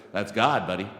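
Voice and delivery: plain, falling statement from a sponsor; matter-of-fact answer. Retold or imitated. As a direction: state it simply, as the obvious answer.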